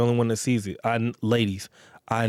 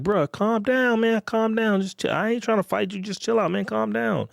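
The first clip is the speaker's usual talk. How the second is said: higher, easy de-escalating voice; calming a dude down; 9 semitones higher than usual. Retold or imitated. Man calming a dude hawking at a woman, soothing. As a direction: talk a heated guy down, pitched up. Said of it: "I'm like".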